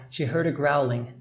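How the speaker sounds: ordinary delivery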